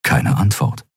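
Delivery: ordinary delivery, nothing marked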